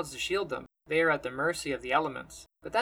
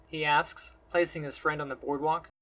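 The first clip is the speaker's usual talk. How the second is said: ordinary delivery, nothing marked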